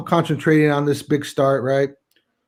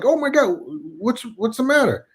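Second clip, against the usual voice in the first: high-pitched retold alarm; startled wife; 7 semitones higher than usual. Startled wife in the shower asks her husband, alarmed. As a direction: imitate a startled wife, voice jumping up. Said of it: "I startled Lisa".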